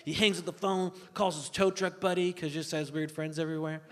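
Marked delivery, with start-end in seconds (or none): none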